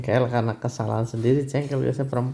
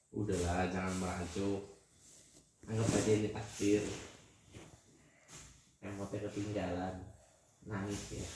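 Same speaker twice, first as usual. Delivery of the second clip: low, very soft coaxing; soothing consolation; 5 semitones lower, 14 dB quieter than usual. Real, not acted. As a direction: console someone sulking, voice dropped right down. Membujuk mama yang merajuk. (membujuk pelan dengan suara rendah)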